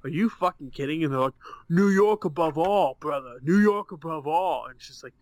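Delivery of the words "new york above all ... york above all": mock New York accent. Mocking Yankee fans by imitating their New York bro accent. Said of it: "that accent right there was ridiculous".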